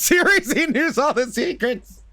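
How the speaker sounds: hammed-up conspiracy excitement, breaking high; mock amazement. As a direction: climb into an over-the-top high cry. Mock fan theory building up.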